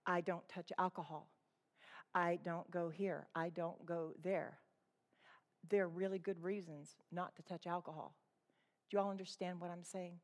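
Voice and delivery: lightly voiced pious rule-keeper; prim legalist voice. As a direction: play the smug moralist, softly. Mocking a teetotal legalist's creed.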